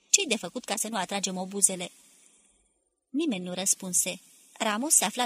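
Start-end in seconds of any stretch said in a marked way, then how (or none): none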